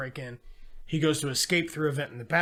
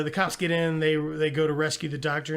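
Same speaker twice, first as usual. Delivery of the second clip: normal talking voice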